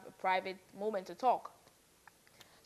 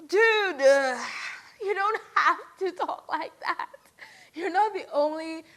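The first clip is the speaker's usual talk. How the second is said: high, loud, laughing exasperation; exasperated scolding; 6 semitones higher, 6 dB louder than usual. Real, not acted. Vlogger telling off housemate for his speech habit, loud exasperation. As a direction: groan it at him, half laughing.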